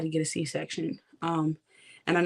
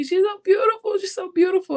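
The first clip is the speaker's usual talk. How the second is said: higher, louder tearful wail; crying; 14 semitones higher, 6 dB louder than usual. Retold or imitated. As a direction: let it break out as a cry, over and over. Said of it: "And I'm just crying".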